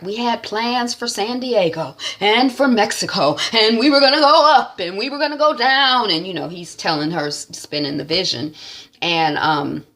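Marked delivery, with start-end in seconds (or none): 0.0-6.1 higher, sweeping visionary voice; grand proctor. Retold or imitated. Head proctor pitching his plans to Alicia, grand and higher. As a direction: sell the big dream. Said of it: "he's like".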